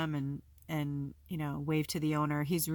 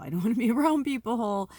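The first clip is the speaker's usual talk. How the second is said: breaks into a real laugh mid-line, higher and louder; exasperated laugh; 7 semitones higher, 7 dB louder than usual. Real, not acted.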